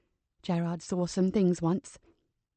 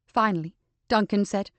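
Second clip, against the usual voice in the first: ordinary delivery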